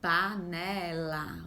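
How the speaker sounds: Panela slowly, the final la held; slow, stretched. Shown as a demonstration. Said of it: "O som final é o lá".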